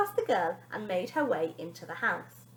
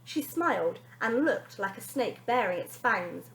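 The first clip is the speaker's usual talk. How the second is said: usual speaking voice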